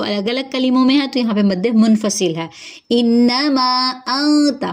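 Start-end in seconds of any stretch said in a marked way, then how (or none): 2.9-4.6 انت with a hidden nasal n before the ta; tajweed recitation. Shown as a demonstration. Teacher reciting for students, very slow. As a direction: recite انما انت very slowly. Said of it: "نون ساکن کے بعد تا ہے، اخفا ہوگا".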